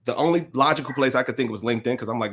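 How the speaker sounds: ordinary talk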